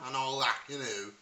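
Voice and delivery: throaty Liverpool Scouse; demonstrating a strong Scouse accent. Shown as a demonstration. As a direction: talk like a Scouser, harsh back-of-throat consonants. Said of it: "is known as Scouse".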